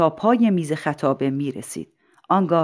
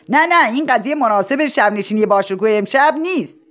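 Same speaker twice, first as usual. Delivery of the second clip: high, raised rebuff; objecting aloud; 6 semitones higher, 4 dB louder than usual. Acted. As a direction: overrule the heckler across the hall. Principal rebuffs heckler across hall. (مخالفت با صدای بلند)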